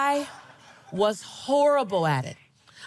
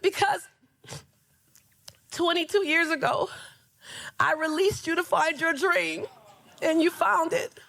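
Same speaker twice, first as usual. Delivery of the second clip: lightly voiced woman, higher and tearful; hint of the crying former boss, pitched up; the old supervisor, moved, lightly voiced; 7 semitones higher than usual. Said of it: "She said".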